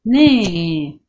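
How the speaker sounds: نا with the aa stretched and loud; نا drawn out loudly for the class; long vowel demo. Shown as a demonstration. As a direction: sing out نا, holding the vowel. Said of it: "بطول وبمد".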